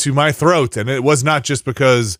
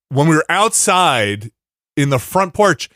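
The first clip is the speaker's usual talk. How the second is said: ordinary delivery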